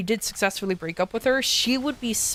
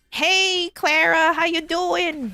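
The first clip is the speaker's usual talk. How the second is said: higher caricature of own greeting; mock version of own voice; 9 semitones higher, much slower than usual. The speaker caricatures her own greeting to Clara, higher. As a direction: send up your own voice, higher. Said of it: "my own interpretation of my voice".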